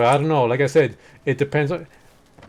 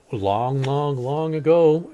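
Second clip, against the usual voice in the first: storytelling opening; 3 semitones higher, much slower than usual. A narrator's reading. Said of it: "starts telling orc lore".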